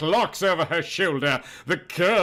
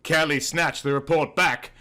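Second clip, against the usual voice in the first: normal talking voice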